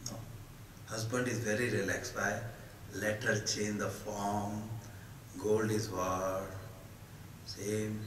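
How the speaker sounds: usual speaking voice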